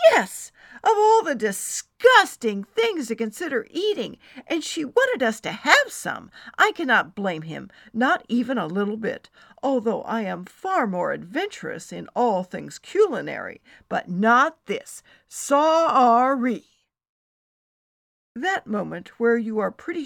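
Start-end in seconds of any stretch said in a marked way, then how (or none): 0.1-6.3 high, loud outrage; revolted complaint with punched words; disgusted rant. Acted. Friend complains about disgusting food, ranting high and loud. 6.6-16.6 loud, insistent, sorry-not-sorry; emphatic refusal. Acted. Friend sides with her husband, loudly emphatic.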